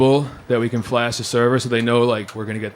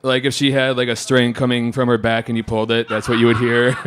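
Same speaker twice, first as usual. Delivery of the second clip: ordinary talk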